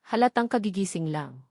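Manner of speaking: usual speaking voice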